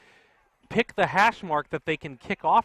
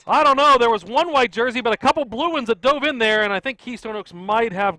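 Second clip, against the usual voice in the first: rising excited call, much higher and louder; excited play-by-play; 8 semitones higher, 11 dB louder than usual. Real, not acted. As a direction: call the loose ball live. Live play-by-play of loose ball.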